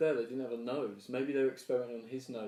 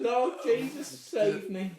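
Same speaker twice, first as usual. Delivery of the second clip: much higher, pleading yokel; hillbilly plea; 9 semitones higher, 3 dB louder, much slower than usual. Pretend. Hillbilly praying in bit.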